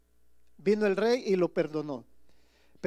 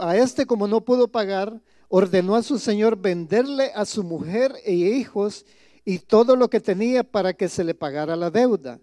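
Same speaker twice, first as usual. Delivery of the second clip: scripture reading; 7 dB louder than usual. Acted. Pastor reading the parable aloud to his congregation, solemn and loud. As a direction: proclaim the verse to the congregation.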